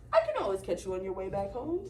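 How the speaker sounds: low, fast troll mutter; sly troll aside; scheming troll. Acted. Troll plotting to himself, low and sly.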